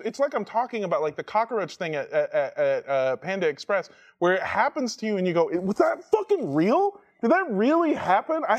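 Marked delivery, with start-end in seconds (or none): 5.6-8.4 high, stretched-out incredulous questions; disbelieving. Retold or imitated. Teller recalling an unbelievable incident, high and incredulous. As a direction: replay your stunned disbelief with pitch raised. Quoting own disbelief afterwards.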